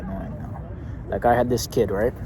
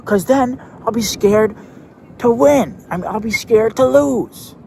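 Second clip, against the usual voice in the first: put-on much higher, louder, slow panicked child; hysterical kid voice; 12 semitones higher, 7 dB louder, slower than usual. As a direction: spiral into a kid's meltdown. Kid going crazy.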